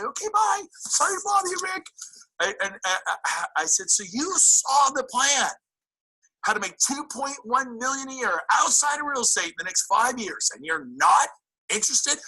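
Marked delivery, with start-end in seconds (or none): 0.0-1.9 high, mock-timid goodbye; meek brush-off. Telling an agent how not to answer, meekly. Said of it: "I didn't just say". 3.9-12.2 incredulous. Shown as a demonstration. Showing agents how to answer a doubter, incredulous. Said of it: "be incredulous with people".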